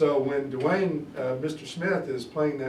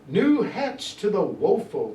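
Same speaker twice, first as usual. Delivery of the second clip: slow, louder and higher Shakespeare recital; dramatic reading; 6 semitones higher, 6 dB louder, slower than usual. Acted. Teacher performs Lennox's speech for the class with dramatic weight. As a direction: declaim it like a stage actor.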